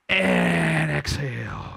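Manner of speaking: slow, drawn-out breathing cue; slow exhale cue. Shown as a demonstration. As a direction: guide the out-breath slowly. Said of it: "breathing cue".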